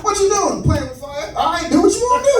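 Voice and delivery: high, fast dismissal; dismissive dad. Retold or imitated. Father on the phone brushing off his son's plan, high and fast. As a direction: brush him off quickly. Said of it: "type of dude my dad is".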